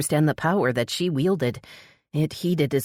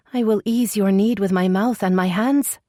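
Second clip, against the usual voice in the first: trembling voice; 6 semitones higher, 4 dB louder than usual. A narrator's reading. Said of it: "Her voice trembled with both nerves and excitement".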